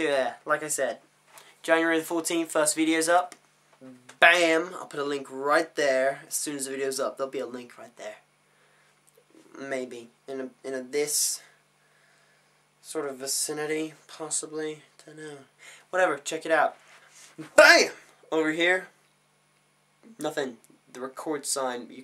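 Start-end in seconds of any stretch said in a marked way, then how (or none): 4.2-4.7 loud, punchy pop; sudden loud burst; punchy exclamation. Pretend. Pointing out a link. 17.5-17.9 high, very loud pop; yelped sound effect; punchy exclamation. Pretend. Pointing out a link.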